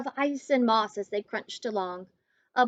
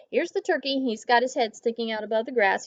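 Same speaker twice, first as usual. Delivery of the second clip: plain reading voice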